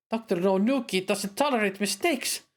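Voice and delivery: high, sharp woman's voice; woman cleric voice. Acted. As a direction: voice the cleric scolding him. Cleric worried.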